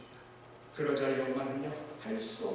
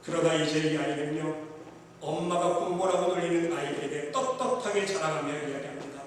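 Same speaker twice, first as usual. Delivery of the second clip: normal talking voice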